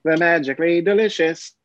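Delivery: a deliberately bad Irish accent as the punchline; mock Irish accent. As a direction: throw in a cheesy Irish lilt. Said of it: "I should have thrown that in at the end".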